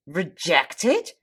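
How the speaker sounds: astonished echo of bad news; incredulous question. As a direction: ask it in shock, voice lifting at the end. Acted shock at news in poem.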